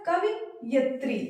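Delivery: कवियत्री said slowly, each syllable clear; slow correct pronunciation. Shown as a demonstration. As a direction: model कवियत्री slowly for the class. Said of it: "तो ये सही शब्द क्या बन जाएगा?".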